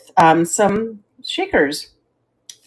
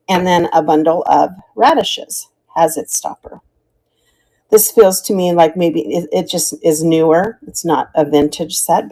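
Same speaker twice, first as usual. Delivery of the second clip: normal talking voice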